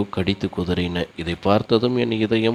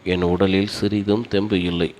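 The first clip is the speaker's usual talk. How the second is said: plain reading voice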